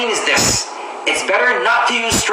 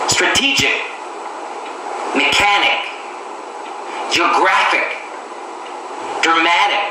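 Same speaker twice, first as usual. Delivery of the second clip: each word said alone, with the syllable before the last one clearly stressed: "Economic", "Dramatic", "Academic"; stress on second-last syllable. Shown as a demonstration. Teacher reading an example list to learners, stressing the second-last syllable. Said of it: "are usually stressed on the second last syllable".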